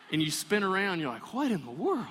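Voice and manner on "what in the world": startled. Retold or imitated. Dad spinning around, startled.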